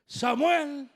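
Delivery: high, loud call of a name. Acted. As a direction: call out to rouse someone asleep. Calls Samuel again, raised voice.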